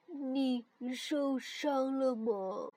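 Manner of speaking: dazed. Acted. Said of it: "晕乎乎地问".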